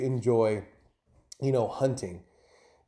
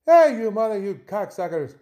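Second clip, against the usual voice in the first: a rough, hammed-up go at an Irish accent, first words high and loud; attempted Irish accent; 10 semitones higher, 6 dB louder than usual. Host greeting Irish listeners with a mock, clumsy Irish accent. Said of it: "I don't even know if that's the right fucking accent".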